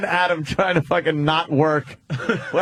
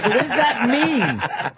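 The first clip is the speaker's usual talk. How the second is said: raised, drawn-out baffled question; retold bafflement; 8 semitones higher, slower than usual. Host retelling his reply to a useless demonstration, baffled. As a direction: stretch it out in laughing disbelief.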